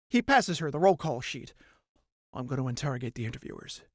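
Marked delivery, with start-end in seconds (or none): 2.3-3.8 low, soft, fast grim mutter; grim resolve. Acted. Mirthafan to Colline, low quick grim mutter. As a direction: say it quietly and darkly.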